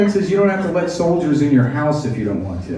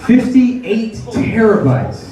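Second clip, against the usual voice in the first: slow emphatic; 6 semitones higher, much slower than usual. Shown as a demonstration. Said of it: "repeated for emphasis".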